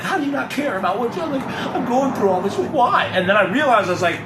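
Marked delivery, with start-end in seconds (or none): 0.0-3.0 high, exasperated mock whine; imitating his younger self. Mocking his old self pestering mom, high whiny voice. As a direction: whine it up high like a frustrated kid. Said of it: "Now I'm like".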